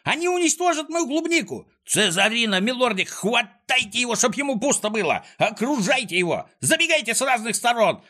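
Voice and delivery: high-pitched panicked commands; shrieking villain urging on dogs; frantic yelling. A narrator's reading. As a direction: holler orders in mounting alarm. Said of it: "орал Клопс".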